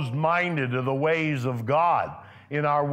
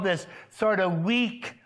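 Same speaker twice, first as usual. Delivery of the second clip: ordinary delivery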